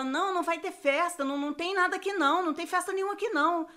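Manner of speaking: the mother cutting her off, firm; insistent mother. Retold or imitated. Mother repeats denial.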